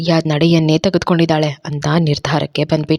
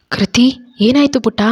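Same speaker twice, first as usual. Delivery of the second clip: alarmed call; 6 semitones higher than usual. A narrator's reading. Mother rushing in to her sick daughter, alarmed call. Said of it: "ಗಾಬರಿಯಿಂದ ಕೂಗ್ತಾ".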